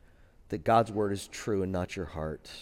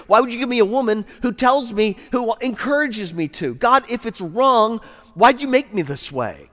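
much higher, louder pleading excuse, often fast; whiny Adam; 10 semitones higher, 11 dB louder than usual. Pretend. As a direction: whine at God like a cornered husband. Adam whining, blaming God.

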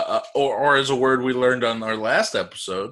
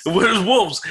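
high, louder mock warning; mock alarm; 9 semitones higher, 4 dB louder than usual. Echoing a friend's wolf joke, high mock alarm. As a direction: squeal the danger back for fun.

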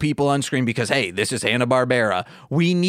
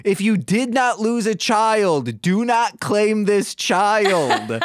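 higher, slow announcement through a laugh; mock public announcement; 6 semitones higher, slower than usual. To the crowd at the bean, a mock public announcement. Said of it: "I did say this out loud".

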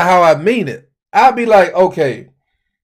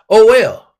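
usual speaking voice

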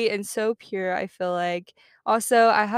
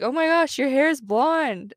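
imitating relatives' surprised reaction, higher and louder; playful imitation of amazed relatives; mock surprise; 6 semitones higher, 4 dB louder than usual. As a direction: exclaim it like astonished family, pitch jumping up. Family reacts to blonder hair.

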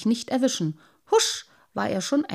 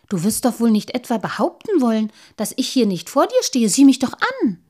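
rising, louder insistence; offended little sprite insisting loudly; indignant protest; 4 semitones higher, 7 dB louder than usual. A narrator's reading.

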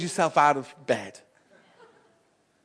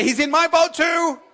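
higher playful voice for the crowd; louder, higher mock reply from the pews; mock congregation reply; 11 semitones higher, 5 dB louder than usual. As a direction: chirp it like the congregation answering. Congregation told to say it.

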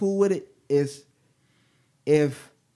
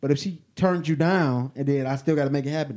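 ordinary delivery

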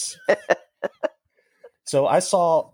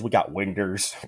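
"winders" said in a drawled Southern way; mock country voice saying winders; lightly hammed-up Southern accent; Southern dialect; 3 semitones lower, 4 dB quieter than usual. Shown as a demonstration. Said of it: "We don't have windows".

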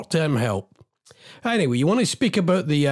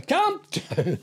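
laughing at self; 9 semitones higher than usual. Real, not acted.

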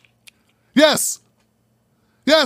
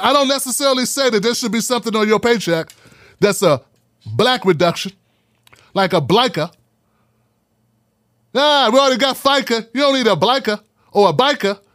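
normal talking voice

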